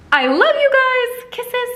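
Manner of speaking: high, slow gushing goodbye; gushy sign-off. Real, not acted. Saying goodbye to viewers, high gushing. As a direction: blow kisses to viewers.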